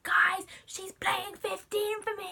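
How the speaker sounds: high, drawn-out mock squeal of news; re-enacted fangirl excitement, high and stretched; excited announcement. Retold spoiling surprise to friends.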